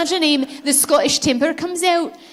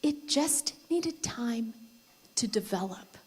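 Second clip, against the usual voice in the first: slow and soft; 9 dB quieter, slower than usual. Real, not acted. Dramatic punchline of story.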